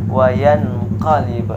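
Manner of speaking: wayan qalib with a crisp n, no hum; wrong reading. Shown as a demonstration. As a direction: say wayan qalib clipped, unhummed. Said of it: "sekali lagi yang salah". (wayan qalib dibaca jelas tanpa dengung)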